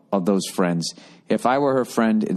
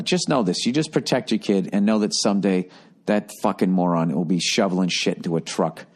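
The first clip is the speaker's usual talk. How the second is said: ordinary talk, nothing marked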